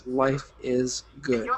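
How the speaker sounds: savoring calm. Pretend. To listeners imagining the vacation, slow contented savoring. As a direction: say it like you're sinking into a hammock. Daydream of relaxed family vacation.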